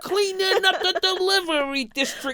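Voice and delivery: high, hammed-up heroic boast; mock vigilante. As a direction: proclaim it as a parody action hero. Parodying the vigilante line about parcels.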